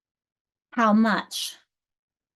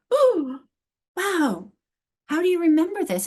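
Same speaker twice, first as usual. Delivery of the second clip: high, bright mock amazement; amazed learner; 8 semitones higher, 4 dB louder than usual. Learner overwhelmed by English adjective rules, high surprised voice. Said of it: "you get like".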